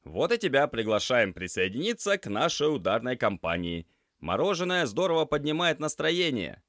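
Semyon, playful invitation. Acted. Semyon invites the gloomy girl for ice cream, playfully. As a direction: sell the ice cream idea like a cheeky salesman.

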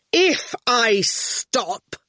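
very slow, grudging grate; grating voice. A narrator's reading. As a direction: grind each word out separately. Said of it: "his voice grated".

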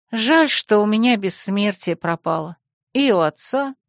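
plain reading voice

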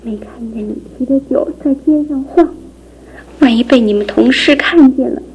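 ordinary talk

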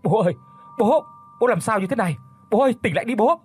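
high, loud cries to a father; urgent, high-pitched pleading yell; distraught scream. A narrator's reading. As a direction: shout in shock and desperation. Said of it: "Anh Nhất hét lên".

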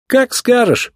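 high, flippant retort; smirking. A narrator's reading. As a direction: shrug it off with a smirk. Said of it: "усмехнулся Маккуин".

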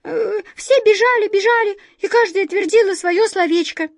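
very high, loud little mouse; tiny flustered mouse voice; sheepish squeak. A narrator's reading. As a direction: squeak out an embarrassed confession. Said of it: "смущённо пискнула одна из мышек".

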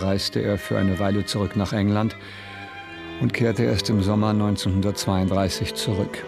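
ordinary talk

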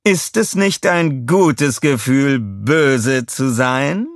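slow, high, loud villain purr; villainess voice. A narrator's reading. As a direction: savor the word böse. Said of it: "Ihre Stimme schallte durch den Saal".

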